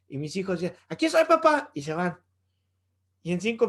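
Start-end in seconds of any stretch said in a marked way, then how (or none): 0.9-1.7 put-on high, loud little kid calling out; playful child reporting to dad; child's voice. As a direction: chirp it like a small boy checking in. Child answering father in story.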